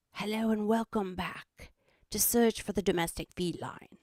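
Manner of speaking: hammed-up Australian nature-show host; mock Australian host intro; Steve Irwin. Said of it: "I would channel my inner Steve Irwin".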